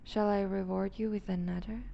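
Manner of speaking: ordinary talk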